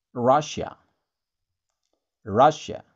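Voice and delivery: Russia said as RAH-sha; English Russia. Shown as a demonstration. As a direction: Russia with a short a and sh sound.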